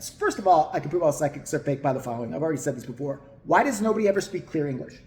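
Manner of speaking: normal talking voice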